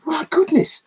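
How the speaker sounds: high, wowed exclamation; astonished praise from the director; amazed. Retold or imitated. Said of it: "He says".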